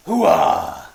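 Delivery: loud barked Pacino hooah. Retold or imitated. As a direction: shout it like Al Pacino. Pacino catchphrase impression.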